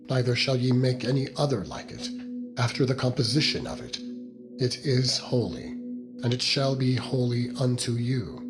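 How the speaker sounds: ordinary delivery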